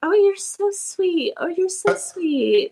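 high, slow sweet cooing; nice, imitated. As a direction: imitate a kind author cooing at a fan. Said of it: "she was so nice".

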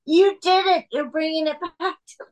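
mimicking a thrilled studio boss, high-pitched; high, hurried imitation of jubilation; jubilant. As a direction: replay the triumphant congratulations in mock excitement. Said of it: "gets picked up and swung around".

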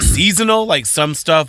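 usual speaking voice

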